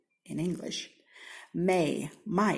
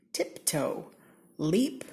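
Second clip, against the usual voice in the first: ordinary delivery, nothing marked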